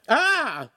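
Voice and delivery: high, loud cry of pain; sharp high yelp after a blow; pained scream. A narrator's reading. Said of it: "Lincoln screamed".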